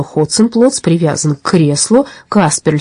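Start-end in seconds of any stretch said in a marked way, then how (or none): none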